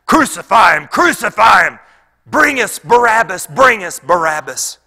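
loud, high mob shout; crowd shouting. Acted. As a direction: shout it like a crowd demanding blood. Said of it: "the crowd began to shout".

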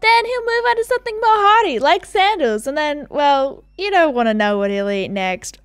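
high, loud start that settles down; teasing character voice, trailing into a hint; ominously playful dude. Acted. Shark owner hinting what Bruce eats next, playful tease.

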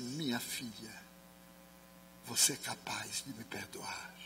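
slow, faint whisper; dying father whispers to his daughter. Retold or imitated. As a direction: whisper it from the deathbed.

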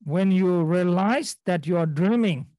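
usual speaking voice

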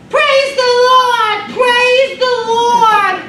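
put-on very high, loud, slow screeching old lady; shrill sung-out old woman praising; screeched high-pitched praise. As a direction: screech it out like an elderly church lady. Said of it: "she kinda had this screeched, high-pitched voice".

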